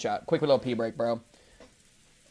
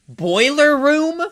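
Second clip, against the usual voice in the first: shocked disbelief; 11 semitones higher, 6 dB louder, much slower than usual. Real, not acted. Incredulous squeal.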